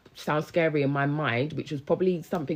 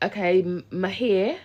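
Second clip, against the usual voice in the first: ordinary talk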